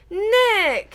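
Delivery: a high, loud roaring greeting; roared. A narrator's reading. Hunt leader hailing Nick, roaring jovially. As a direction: boom his name across the hall. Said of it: "he roared".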